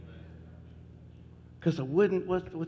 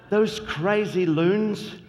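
hammed-up English accent, higher and slow; mock English accent; 6 semitones higher, 5 dB louder, slower than usual. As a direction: play a posh Englishman, half joking, with an English accent. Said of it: "talking with an English accent".

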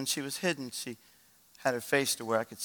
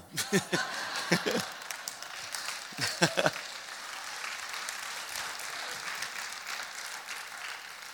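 airy laugh; 6 semitones higher, 7 dB quieter than usual. Real, not acted. Laughing at own joke.

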